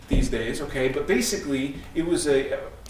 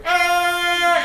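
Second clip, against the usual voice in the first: high, loud startled yelp; sudden high cry, loud; retold scream; 14 semitones higher, 8 dB louder than usual. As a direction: yelp like something's gone wrong. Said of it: "and I hear".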